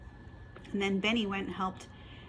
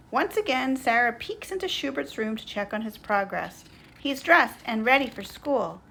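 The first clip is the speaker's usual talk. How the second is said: lively, louder storybook narration; children's story reading; 4 semitones higher, 8 dB louder than usual. A narrator's reading. Narrator reading Schubert's morning to children, lively storytelling. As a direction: read it out brightly for little listeners.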